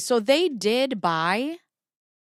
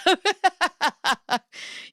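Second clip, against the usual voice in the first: high giggle; 9 semitones higher than usual. Real, not acted. Laughing at her joke.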